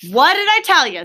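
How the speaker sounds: told-you-so. Acted. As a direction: rub it in a bit. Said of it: "said the rat".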